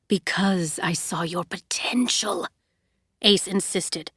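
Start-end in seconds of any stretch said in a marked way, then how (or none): 0.1-2.5 hushed, breathy insistence; whispered. Acted. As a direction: whisper it close to her. Ace answers intimately.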